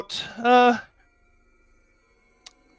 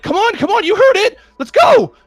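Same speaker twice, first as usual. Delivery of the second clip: urgent; 6 dB louder than usual. Acted. Wife pulling husband to flee.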